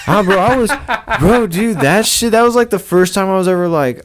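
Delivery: real excitement recalling his first dog; excited. Real, not acted. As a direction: blurt it out, thrilled. Excited retelling of first pet.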